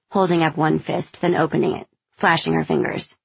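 usual speaking voice

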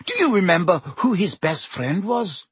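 usual speaking voice

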